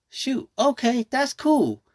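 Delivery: high, lively mock surprise; a child's cheerful okay, replayed; surprised delight. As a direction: play the kid shrugging it off happily. Retold reaction to elephant costume.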